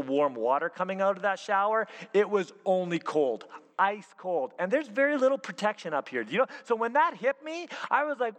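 ordinary delivery

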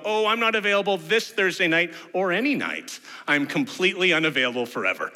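mock excuse, rattled off at the end; emphatic excuse. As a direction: beg off with comic insistence. Acted excuse to dodge helping.

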